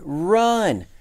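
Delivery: high, loud warning shout; panicked shout to flee; urgent cry. A narrator's reading. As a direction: yell it as an alarm, drawn out. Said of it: "cried the town mouse".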